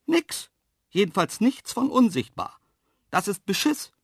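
high, indignant protest; angry call. A narrator's reading. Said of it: "rief ich böse". (böse, empört rufen)

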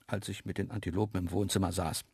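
plain reading voice

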